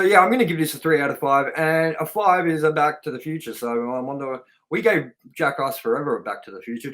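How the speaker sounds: ordinary delivery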